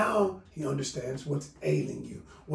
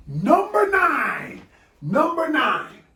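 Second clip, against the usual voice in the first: drawn-out announcement; 10 semitones higher, 7 dB louder, much slower than usual. Shown as a demonstration. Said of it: "announcing the point".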